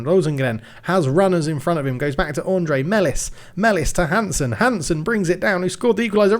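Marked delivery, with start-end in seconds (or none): 2.8-6.4 excited commentary. Real, not acted. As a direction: shout the goal out. Winning goal commentary.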